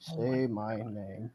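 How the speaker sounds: low, soft, slow movie-villain line; Heisenberg. Retold or imitated. Co-host echoing the joke to a friend, slow quiet impression. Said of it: "gonna be like Heisenberg".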